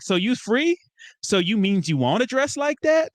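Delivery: high, rising mock-puzzled voice; girl's incredulous question. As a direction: imitate a girl questioning in disbelief. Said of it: "the girl's like".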